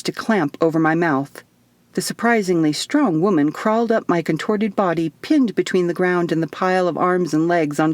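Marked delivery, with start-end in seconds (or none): none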